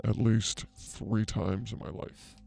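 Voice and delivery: slow, soft fortune-teller voice; a psychic slowly intoning a solemn answer, slow and soft; mystic pronouncement. Acted. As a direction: intone it like a seer in a trance.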